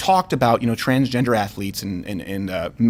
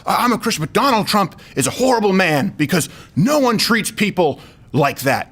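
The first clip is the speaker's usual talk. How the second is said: raised-pitch mock rant; going nuts; 6 semitones higher than usual. Chicago guy ranting about Trump, going nuts. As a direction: imitate someone worked up about a politician. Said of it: "he starts going nuts".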